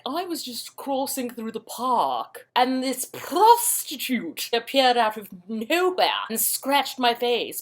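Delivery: slow, high, drawling posh British; snotty upper-crust British. Retold or imitated. British dude complaining to the policeman, snotty upper-crust drawl. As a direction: caricature an affronted British toff. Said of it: "snotty, upper crusty British dude like".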